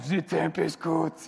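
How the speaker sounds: loud mock European accent, drawn out; weird European accent. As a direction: belt it in a silly European accent. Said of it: "what have I got in my head?".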